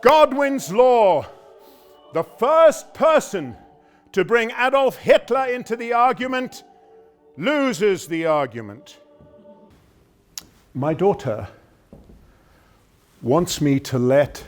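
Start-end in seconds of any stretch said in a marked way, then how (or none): 0.0-9.0 emphatic comeback. Acted. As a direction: declare the rule forcefully to win the quarrel. Triumphant retort in acted quarrel.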